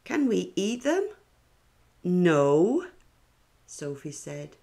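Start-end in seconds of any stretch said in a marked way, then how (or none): 0.0-1.1 put-on high, loud kid's question; curious child. A classmate asking if the seeds can be eaten, high and eager. 2.0-2.9 loud, flat no; firm no. Acted. Sophie telling a classmate not to eat seeds, loud and firm.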